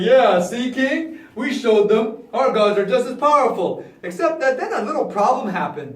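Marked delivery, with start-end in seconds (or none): 0.0-3.8 loud, triumphant boast; proud. Acted. Magician boasts to the king about gods, loud pride. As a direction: crow to your king. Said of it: "And I was so proud".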